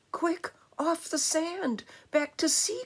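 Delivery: high, urgent little snail; storybook snail calling in alarm; urgent cry. A narrator's reading. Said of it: "cried the snail".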